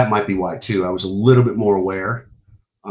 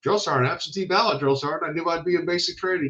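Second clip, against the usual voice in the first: high-pitched formation reply; acted recruit sounding off; recruit reporting; 8 semitones higher than usual. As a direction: answer the drill sergeant crisply by rank. Recruit answering the drill sergeant.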